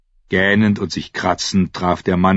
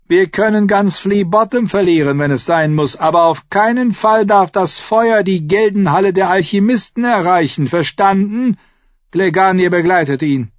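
higher, lightly voiced dwarf lord; Tyrion's voice; 12 semitones higher, 6 dB louder than usual. Tyrion answers the Hound about the horse, higher voice. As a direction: pitch up into Tyrion's quick voice.

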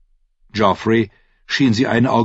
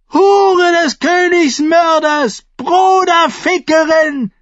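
slow, drawn-out high screech; shrieking; 6 dB louder, slower than usual. A narrator's reading. Grieving mother accusing the queen, shrieking. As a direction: scream each insult out long and loud. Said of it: "kreischte sie".

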